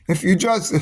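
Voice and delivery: ordinary talk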